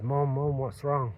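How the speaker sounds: high, loud alarmed call up to mum; panicked child shouting for his mother; frightened calling out. Retold or imitated. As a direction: call out to her, scared and urgent. Teen calling to mum in distress.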